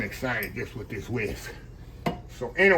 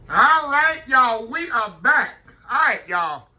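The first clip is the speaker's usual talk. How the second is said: high, loud hyped greeting; loud return; 10 semitones higher, 7 dB louder, slower than usual. Real, not acted. Host greeting viewers back after the break, loud and high. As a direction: burst back in with energy.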